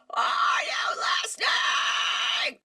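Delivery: slow breathy raspy scream; distorted scream. Retold or imitated. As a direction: scream it like a metal singer. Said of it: "The beginning of their most famous song".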